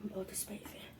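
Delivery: breathless unvoiced whisper. Real, not acted. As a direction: rasp it out, throat burning. Overwhelmed by chilli heat.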